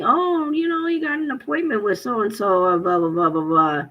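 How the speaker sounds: high-pitched sing-song official spiel; mocking imitation. Retelling an official reciting appointment details, mocking. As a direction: start high, sliding down into dismissive blahs.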